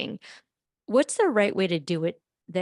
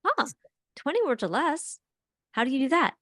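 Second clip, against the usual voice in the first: networking stranger; 7 semitones higher than usual. Pretend. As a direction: play a friendly stranger opening small talk. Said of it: "let's do it".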